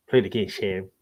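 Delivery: low, soft movie-line delivery; film quote impression. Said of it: "you know that film".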